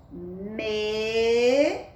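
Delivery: "Mesa" said slowly, the "me" held long; syllable demo. Shown as a demonstration. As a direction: stretch "Mesa" to show its syllables. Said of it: "fica o som me". ("Mesa" bem alongado, sílaba por sílaba)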